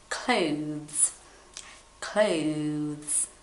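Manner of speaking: Clothes said slowly, soft th then z, ending in a light s; clear pronunciation demo. Shown as a demonstration. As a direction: say Clothes softly, gliding from th to z. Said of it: "pack my clothes".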